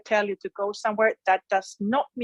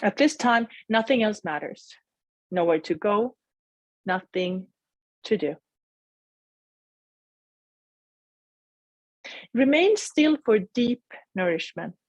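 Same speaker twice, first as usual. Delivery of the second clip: guided meditation. Acted. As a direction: gently guide them inward.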